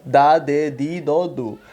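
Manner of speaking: "di" with a pure D, no J sound; every syllable of "dá, de, di, do, du" on the same hard D; northeastern hard D. Shown as a demonstration. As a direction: recite "dá, de, di, do, du" with hard northeastern Brazilian D. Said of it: "do português do nordestino".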